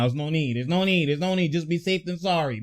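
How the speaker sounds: soft, repetitive mock reassurance; imitated reasoning. Imitating someone justifying the timeout, soft reasoning. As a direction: reason it away quietly. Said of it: "he's like".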